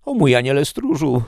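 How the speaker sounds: high, loud plea; calling out. A narrator's reading. Prisoner appeals to his guardian angel, calling out. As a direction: cry out to a guardian in distress. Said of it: "zawołałem".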